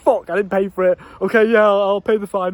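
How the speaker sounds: put-on scared kid caving in. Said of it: "I was shitting myself".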